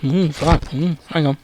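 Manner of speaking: soft muttered aside; quiet mutter while searching the pages; muttering to herself. A narrator's reading. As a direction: mumble it under your breath. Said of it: "muttering to herself".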